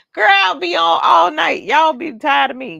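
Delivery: loud, high laughing tease; laughing, loud teasing to the viewers; laughing shout. Real, not acted.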